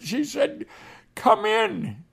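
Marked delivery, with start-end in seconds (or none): 1.1-2.0 high, eager "Come in"; a lightly voiced Indian woman inviting him in; woman's welcome. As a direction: voice her glad invitation, pitched high.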